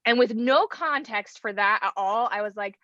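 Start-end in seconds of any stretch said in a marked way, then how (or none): none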